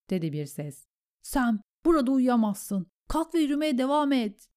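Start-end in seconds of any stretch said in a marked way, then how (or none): 1.2-4.5 higher, insistent voice; urging comrade. A narrator's reading. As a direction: press an exhausted friend onward. Gren rouses exhausted Sam. (ısrarcı, yüksek perdeden)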